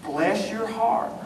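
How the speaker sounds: soft, slow mock-sweet Bless your heart; a soft slow sugary blessing. Shown as a demonstration. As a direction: purr it like a kind insult. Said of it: "this great little phrase".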